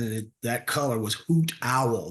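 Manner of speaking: ordinary talk, nothing marked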